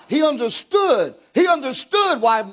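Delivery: rising, slowing fiery preacher shout; preaching at full force. Acted. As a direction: hammer it home to the congregation.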